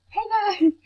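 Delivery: high bright hey guys; upbeat channel intro; cheery vlog greeting. Shown as a demonstration. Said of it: "you say".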